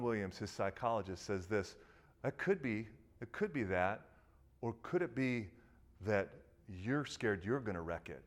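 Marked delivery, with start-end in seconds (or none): 2.2-8.2 serious gravity. Retold or imitated. Psychologist challenging a troubled young man, grave and quiet. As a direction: say it gently and gravely, leaving pauses. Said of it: "all this seriousness and gravity".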